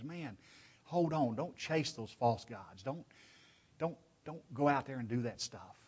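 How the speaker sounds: soft, halting plea; loving warning. Acted. Pleading with brethren not to chase false gods, soft loving warning. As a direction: urge them gently, very quietly. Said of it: "it's a loving warning from Joshua and from God".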